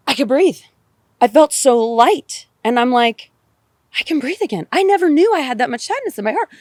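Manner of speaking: joyful, lightened voice; elated relief. Real, not acted. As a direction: let the relief lift your voice up high. Joyous relief after release.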